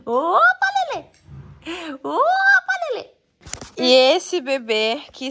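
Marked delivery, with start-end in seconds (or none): 0.0-3.1 high squeaky "opa". Real, not acted. Playful calls to baby. (chamado brincalhão)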